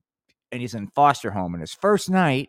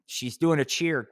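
normal talking voice

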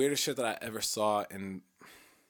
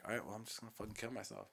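resigned mutter; 10 dB quieter, faster than usual. Retold or imitated. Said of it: "me being like".

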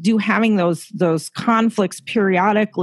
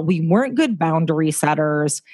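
ordinary talk, nothing marked

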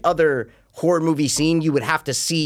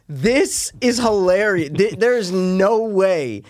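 amused disbelief; 5 semitones higher, slower than usual. Retold or imitated. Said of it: "I go".

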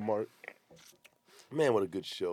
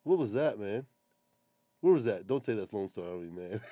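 usual speaking voice

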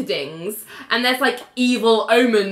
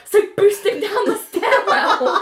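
laughing Elaine; 9 semitones higher than usual. Retold or imitated. Retelling Elaine's advice, high laughing voice. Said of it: "And Elaine's like".

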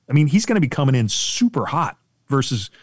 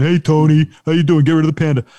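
louder, gruff co-worker call; mock worker; 4 semitones higher, 6 dB louder than usual. Worker calling to Tony, mock shout. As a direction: holler to a colleague. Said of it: "worker voiced".

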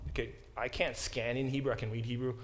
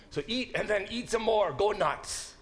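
raised, excited push on "eat"; emphatic; 7 semitones higher, 4 dB louder than usual. Shown as a demonstration. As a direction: urge "eat" and "Go nuts" hard, pitched up. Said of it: "the emphatic construction".

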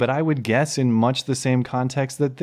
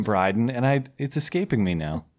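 ordinary talk, nothing marked

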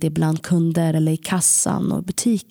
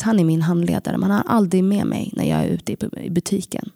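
usual speaking voice

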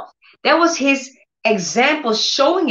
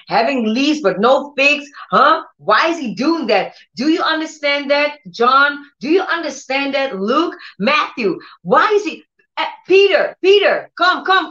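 higher-pitched, agitated pestering of listeners; mock complaining; 3 semitones higher than usual. Preacher acts out Jesus grumbling to disciples, mock complaint. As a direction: complain in rising exasperation, calling people over name by name.

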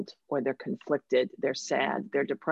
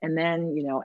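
ordinary talk, nothing marked

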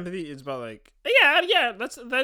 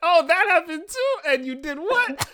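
high, loud fake delight, laughing through it; forced enthusiasm; 7 dB louder, slower than usual. Jealous friend hearing about someone's wins, hammed-up forced enthusiasm. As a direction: gush with a forced, straining smile. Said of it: "consciously having to keep yourself smiling".

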